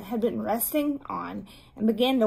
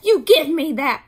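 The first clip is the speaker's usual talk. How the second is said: loud, raised-pitch telling-off; sharp, loud order in a higher voice; angry scolding; 5 semitones higher, 7 dB louder than usual. A narrator's reading. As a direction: scold a little sister at full voice. Beezus scolds Ramona, Wayne demands.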